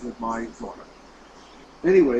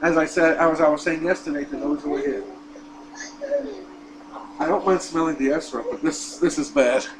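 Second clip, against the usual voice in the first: ordinary delivery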